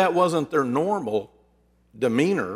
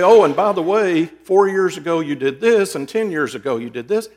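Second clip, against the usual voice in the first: louder, higher mock grievance, listing old faults; mock accusing nag; 3 semitones higher, 6 dB louder than usual. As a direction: spit out the old grudges like a scorekeeper. Mocking someone dredging up past.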